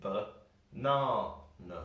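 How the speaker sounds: ba-na-na very slow, long NA, short uh either side; exaggerated middle stress. Shown as a demonstration. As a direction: stretch out ba-na-na syllable by syllable, stressing the middle. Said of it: "I'm putting a lot of stress in the word".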